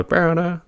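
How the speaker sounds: high-pitched funky synth mimic; high, held little sound imitation; imitated synth sound. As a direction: vocalize a quick synth riff up high. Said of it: "the sound on top of that, they go".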